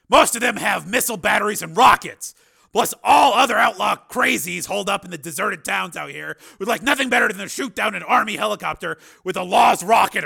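high, loud, forceful explanation; Steele, emphatic. Acted.

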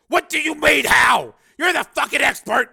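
high, loud outraged roar; angry full-force yell; furious shouting. Acted. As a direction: bellow it in disbelief. Major yells at scientist.

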